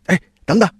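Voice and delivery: a mild shout after someone leaving; calling out. A narrator's reading. Goose seller calls after the departing old man, calling out. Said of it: "他喊道".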